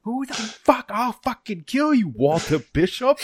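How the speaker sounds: mock furious Boston guy yelling down the phone; over-the-top Boston accent rant; angry Boston accent. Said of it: "the correct Bostonian response".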